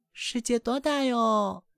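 high, slow, wide-eyed little ducklings; ducklings in chorus. A narrator's reading. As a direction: ask it like small amazed chicks. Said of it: "小鸭子齐声说道".

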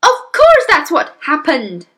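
loud, high exasperation; mother crying out. Acted. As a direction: cry out as the truth dawns. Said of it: "the mother cried".

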